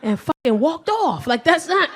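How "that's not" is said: normal talking voice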